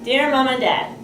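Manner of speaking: ordinary delivery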